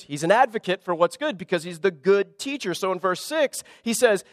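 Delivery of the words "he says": ordinary talk, nothing marked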